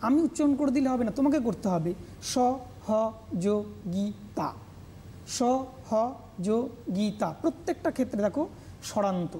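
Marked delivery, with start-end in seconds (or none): 2.3-3.7 সহযোগিতা very slowly, a gap after every syllable; syllable-by-syllable demonstration. Shown as a demonstration. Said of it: "কীভাবে উচ্চারণ করছি". 5.4-7.3 সহযোগিতা very slowly, a gap after every syllable; syllable-by-syllable demonstration. Shown as a demonstration. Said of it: "কীভাবে উচ্চারণ করছি".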